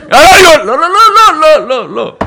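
high, loud, frantic no no no; hammed-up outburst. Husband throwing a tantrum at his wife, high and loud. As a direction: yell it like a tantrum.

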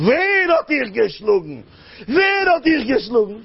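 slow, high, mounting demand; outraged father. Acted. Father asking son who beat him, slow indignant shouting.

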